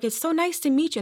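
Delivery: sweetly welcoming, voice lifted high; retold friendly greeter. Churchgoer greeting a newcomer, retold friendly welcome. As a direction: imitate an eager welcomer, high-pitched.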